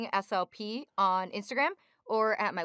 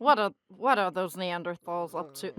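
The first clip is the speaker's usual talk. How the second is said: lower, slow cartoon squid attempt; Squidward voice; 4 semitones lower than usual. Shown as a demonstration. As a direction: try the grumpy squid voice. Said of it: "So if you".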